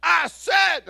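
high loud echoing shout; mock shout back. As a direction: yell it right back at him. Man answers in the same shout.

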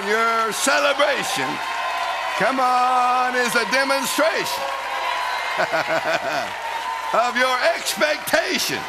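high, exultant preacher's shout; rejoicing. Real, not acted. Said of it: "go ahead and rejoice".

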